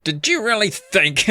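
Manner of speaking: high, slow stagey British villain; attempted British accent. Caped man confronting runaway kids, in a put-on British accent. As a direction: ham up a British accent, John Cleese style. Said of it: "I gotta work up a British accent".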